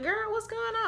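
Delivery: very high-pitched questioning voice; imitated puzzled friend. Friend asking why she stopped, high mock puzzlement.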